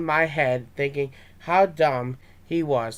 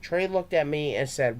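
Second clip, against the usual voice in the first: usual speaking voice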